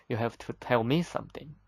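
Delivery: normal talking voice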